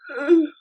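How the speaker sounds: loud, sharp gasp of dismay; shocked gasp. Real, not acted. Vlogger finds a broken compact, shocked gasp. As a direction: gasp at a nasty discovery.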